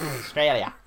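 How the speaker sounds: Australia said as "Ostraylia" in an Aussie accent; an Australian Australia. Shown as a demonstration. He repeats the Aussie way of saying Australia. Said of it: "That's how they say it".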